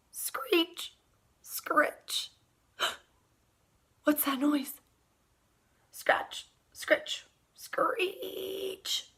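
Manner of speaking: hushed scratching sounds whispered; spooky night noises. Acted. Boy hears scratching at his window at night, spooky whisper. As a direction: make the window noises creep.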